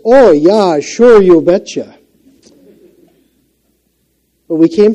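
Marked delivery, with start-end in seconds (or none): 0.1-2.0 slow, louder Minnesota drawl on you betcha; broad Minnesota accent, stretched out. Retold or imitated. Man mocking his own Minnesota accent for the congregation, Minnesota accent. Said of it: "Yes, we do talk like that".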